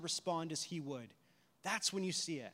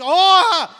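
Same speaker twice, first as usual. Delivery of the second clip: very loud, high mock gasp of horror; hammed-up mortified yelp; catching herself; 13 semitones higher, 25 dB louder than usual. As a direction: cry out as if shocked by your own words. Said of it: "she just caught herself".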